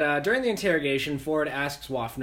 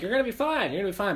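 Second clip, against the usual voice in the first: mock frantic reassurance; 7 semitones higher, much faster than usual. Friends reassuring dying soldier.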